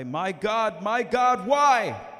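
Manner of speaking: high, loud, rising cry of abandonment; anguished cry. Retold or imitated. Agony echoing Jesus' last cry from the cross, a desperate shout. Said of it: "I heard him cry".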